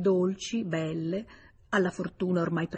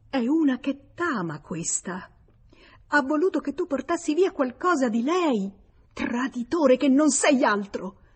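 higher and louder, close to crying; furious, near tears; 6 semitones higher, 4 dB louder than usual. Acted. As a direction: spit out the jealous rage. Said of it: "balbettava furibonda, stizzita e lì lì per scoppiare in lacrime". (furibonda, sul punto di piangere)

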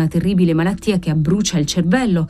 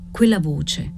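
ordinary delivery

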